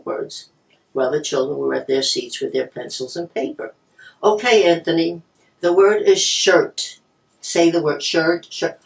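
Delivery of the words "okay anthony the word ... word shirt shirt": loud, slow, over-enunciated classroom voice; patient schoolteacher projecting to a pupil; teacher dictating. Retold or imitated. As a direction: dictate the spelling word loudly, stretching it out. Teacher calling out spelling word slowly.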